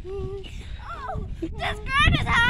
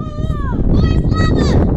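loud, slow drawn-out call; 6 semitones higher, 8 dB louder, much slower than usual. Real, not acted. Kid calling louder for the game.

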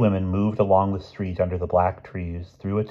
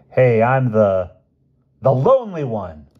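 playful spooky prankster; 5 semitones higher, 8 dB louder than usual. Acted. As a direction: swoop up and stretch it like a teasing bogeyman. Prankster jumps out at women.

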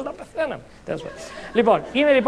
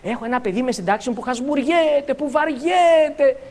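drawn-out mock complaint, rising higher at the end; complaining teacher; 3 semitones higher than usual. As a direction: imitate a teacher moaning about a bored pupil. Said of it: "γυρίζει μια άλλη δασκάλα και μου λέει".

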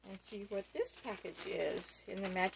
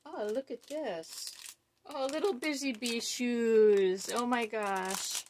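excited; 4 semitones higher, 5 dB louder than usual. Real, not acted. Delighted by gift shoes.

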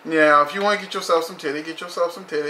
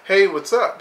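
friendly greeting; 6 semitones higher, 4 dB louder than usual. Retold or imitated. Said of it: "to say".

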